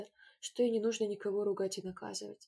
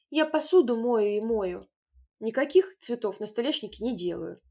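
indignant louder cry; shouting girl; 9 dB louder than usual. A narrator's reading. Taiska to the others, indignant shout. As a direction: throw the blame back loudly. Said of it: "закричала Таиска".

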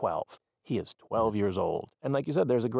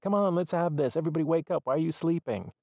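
partying mother; 7 semitones higher, faster than usual. Retold or imitated. Mother wakes sleeping kids for sushi, high and cheery. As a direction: wake the kids like it's a party. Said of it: "and it's like".

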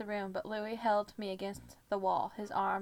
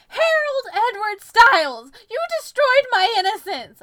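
horrified scream; 11 semitones higher, 16 dB louder than usual. A narrator's reading. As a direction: scream the full name in outrage. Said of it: "I screamed with my eyes closed".

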